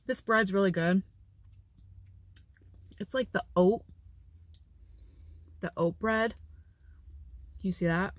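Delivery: talking through sticky peanut butter; words round a sticky mouthful; mouth full of peanut butter. Real, not acted. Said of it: "It's hard to talk with peanut butter".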